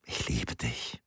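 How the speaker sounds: very soft, low whisper; hushed declaration of love; whispered confession. A narrator's reading. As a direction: breathe it intimately to a lover. Laura's intimate love confession in boat.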